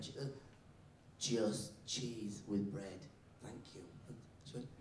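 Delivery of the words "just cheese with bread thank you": very slow, soft and low; slow, polite repeat. Acted. New kid repeating lunch order to teacher, slowly and softly. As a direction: repeat the order word by word, quietly.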